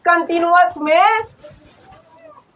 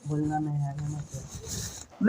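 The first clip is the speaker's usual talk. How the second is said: hushed aside; 12 semitones lower, 18 dB quieter than usual. Real, not acted. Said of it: "hushing students".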